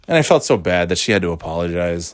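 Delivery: ordinary delivery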